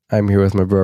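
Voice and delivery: ordinary delivery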